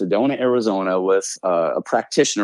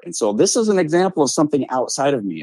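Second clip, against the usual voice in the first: normal talking voice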